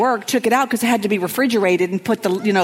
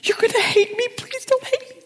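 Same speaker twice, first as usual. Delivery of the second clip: much higher pleading whisper; higher guilty whispered plea; guilty whimper. Retold or imitated. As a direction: beg forgiveness in a tiny high voice. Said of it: "Claudia when she's guilty".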